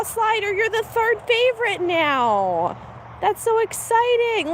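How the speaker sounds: high, slow and sing-song. Real, not acted. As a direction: dote on the calf. Cooing to the calf.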